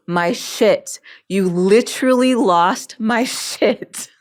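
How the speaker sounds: high, loud and slow through laughter; can't hold back the laugh as you say it; laughing blurt. Retold or imitated.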